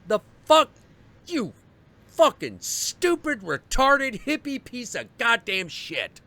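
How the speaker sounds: high, loud furious tirade; furious retold rant. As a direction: let loose on the guy, high and loud. Going off on the man.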